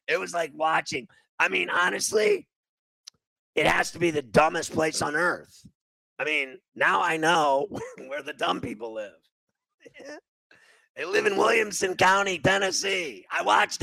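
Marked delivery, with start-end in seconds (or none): none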